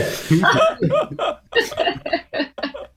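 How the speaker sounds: long high burst of laughter; laughing. Real, not acted. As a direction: crack up high. After retold shout.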